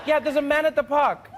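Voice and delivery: high, insistent caller; worried caller. Acted. Caller explains the man at the park, insistent. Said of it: "South African operators doing nine one one".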